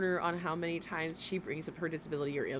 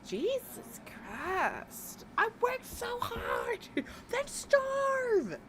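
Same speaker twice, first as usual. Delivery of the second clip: mocking whine; much slower than usual. Mocking the woman in video.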